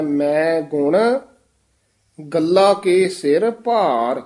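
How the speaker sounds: long pause after ਗੁਣ; ਮੈਂ ਗੁਣ, clear stop, then ਗੱਲਾ ਕੇ ਸਿਰ ਭਾਰ; careful pause. Shown as a demonstration. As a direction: demonstrate the break between ਗੁਣ and ਗੱਲਾ. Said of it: "ਇਹ ਵਿਸਰਾਮ ਧਿਆਨ ਨਾਲ ਲਾਉਣਾ ਹੈ".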